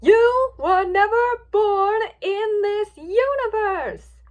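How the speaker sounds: huge slow high bellow; caps-lock voice. A narrator's reading. Luna reminding Twilight of the truth, all-caps shout. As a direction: boom out every word, drawn out and loud. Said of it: "she said in her cap's voice".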